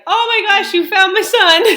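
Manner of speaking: mother hearing her lost son is found, relieved joyful cry; overjoyed relief. Retold or imitated. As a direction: blurt it out, overwhelmed with relief. Relieved call after son found.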